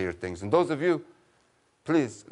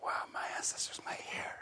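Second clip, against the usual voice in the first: unvoiced conspiratorial whisper; 11 dB quieter than usual. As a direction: hush it so the ancestors can't hear. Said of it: "Why are you whispering?".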